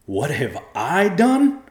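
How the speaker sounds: loud, slow, incredulous rise; venomous. A narrator's reading. Husband turns on his wife, venomous. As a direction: throw the question back with venom. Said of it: "turned on his wife with venom".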